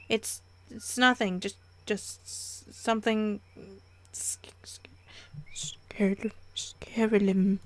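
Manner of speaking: acted halting sobby stammer, fading to whispers; slow, faltering, tearful voice. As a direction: break up the words as if fighting tears. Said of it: "the tears suddenly poured from my eyes out of control".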